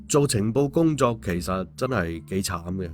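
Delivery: plain reading voice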